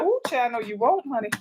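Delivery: soft, knowing put-down; a quiet, sassy "no you won't"; sassy dismissal. Retold or imitated. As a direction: tell her no with a little sass. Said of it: "you be like".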